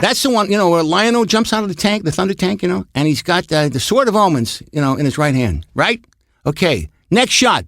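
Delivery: high-pitched, insistent comic-con fan; nitpicking fan. Retold or imitated. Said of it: "They'll say, like".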